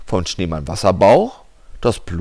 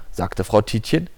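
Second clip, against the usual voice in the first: ordinary delivery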